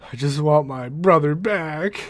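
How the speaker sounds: mock-dramatic Cisco begging; pleading Cisco, pushing harder. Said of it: "Cisco's argument is".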